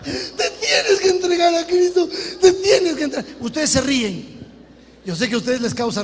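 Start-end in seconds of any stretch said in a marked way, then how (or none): 0.0-3.4 high, loud, laughing through a mock sob; laughing mock plea. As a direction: crack up while imitating the crying mother. Mother's plea, half laughing.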